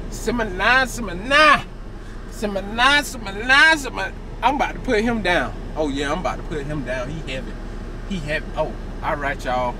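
usual speaking voice

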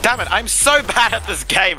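high frustrated wail; raging gamer. Real, not acted. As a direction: whine it out, slowly. Keeps dying in game.

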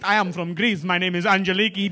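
careful mock Greek accent. Comic mimicking Greek audience member's introduction, Greek accent. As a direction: imitate a Greek woman introducing herself. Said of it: "you sound really Greek at times".

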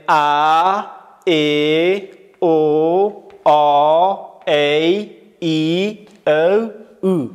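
slow loud drill "ا، ای، او، آ، ای، ای، او، او" as a, e, o, aw, ey, ee, ow, oo; vowel recitation. Shown as a demonstration. Teacher leads everyone together, reciting "ا" to "او" slowly and loudly. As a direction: sound out each vowel long and separate, from "ا" to "او".

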